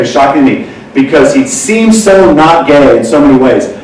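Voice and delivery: usual speaking voice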